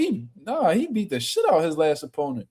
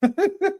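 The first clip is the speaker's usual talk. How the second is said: high laugh. Real, not acted. Reacting to the clip.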